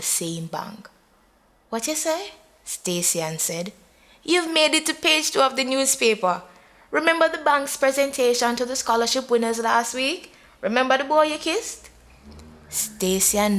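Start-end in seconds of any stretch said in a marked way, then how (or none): 1.7-2.3 high, sharp surprised ask; jolted awake by the news; startled question. A narrator's reading. Groggy woman startled on phone. 4.2-11.9 fast, bright gossip; gossipy friend. A narrator's reading.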